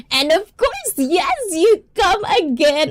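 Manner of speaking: high-pitched, excited squeal of welcome. Real, not acted. Host welcoming a returning viewer, excited. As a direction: greet them with your voice shooting up high.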